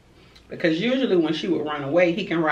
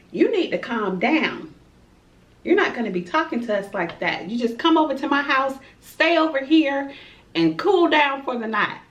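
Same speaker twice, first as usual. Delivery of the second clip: high, scolding grandma; a grandma voice with a put-on accent; 8 semitones higher than usual. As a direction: play the firm grandma calming her down. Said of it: "Y'all like that accent I have".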